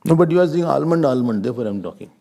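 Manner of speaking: ordinary delivery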